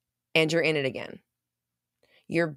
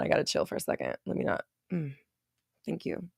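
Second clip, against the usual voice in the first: tearing up; 3 semitones lower, 9 dB quieter, faster than usual. Real, not acted. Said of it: "I'm literally tearing up".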